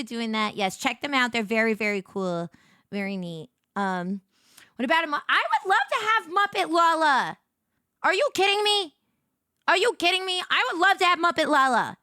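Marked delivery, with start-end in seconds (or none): 4.8-12.0 excited gushing. Real, not acted. Excited wish for a Muppet.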